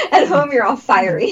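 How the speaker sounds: teasing through a high laugh. Real, not acted. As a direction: laugh as you tease her.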